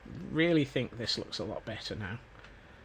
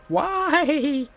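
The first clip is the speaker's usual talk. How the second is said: high, loud comic wail; mock cry; 14 semitones higher, 8 dB louder than usual. Beginning the day with a why, mock wail.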